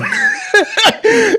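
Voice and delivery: big high burst of laughter; loud laugh. Real, not acted. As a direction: burst out laughing at his own joke. Laughs at own joke.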